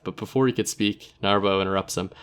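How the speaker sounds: ordinary delivery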